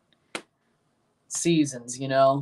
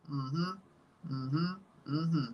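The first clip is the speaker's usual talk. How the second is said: usual speaking voice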